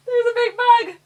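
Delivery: high, loud squeal, stress on bug; panicked squeal. Real, not acted. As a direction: shriek it again at the bug. Sees a big bug.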